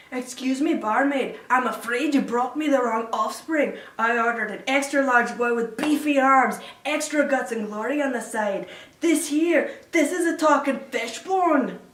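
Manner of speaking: loud mock tirade of a father; disappointed father. Son imitating father complaining about wrong offspring, indignant. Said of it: "it's always with this disappointed scowl".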